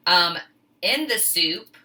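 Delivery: normal talking voice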